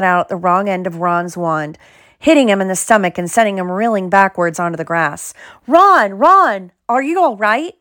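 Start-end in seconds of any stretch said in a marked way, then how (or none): 5.7-7.7 high-pitched, worried cry; squealing in alarm for a friend. A narrator's reading. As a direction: call out loud and high, then high with concern. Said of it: "squealed Hermione".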